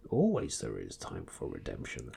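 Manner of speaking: put-on low, soft wise old alien; Yoda voice.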